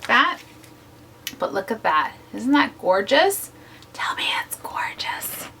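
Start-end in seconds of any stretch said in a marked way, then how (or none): 3.9-5.5 breathy little whisper. Real, not acted. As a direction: whisper it, coaxing. Whispered plea over the page.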